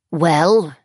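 a cold queen demanding an answer; haughty faerie queen. A narrator's reading. Crowned woman to the silent child: imperious. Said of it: "said the woman in the crown".

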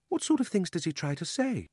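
put-on high-pitched, curious child's question; curious young girl. Polly quizzes the boy about his uncle, high curious voice. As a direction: ask it high, eager to know.

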